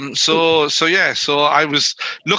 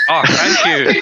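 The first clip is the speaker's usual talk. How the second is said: laughing; slower than usual. Real, not acted. Thanked for compliment on accent.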